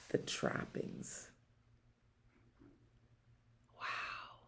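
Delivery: soft awed whisper. Acted. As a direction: fade the list into a whisper. Hushed reading to child.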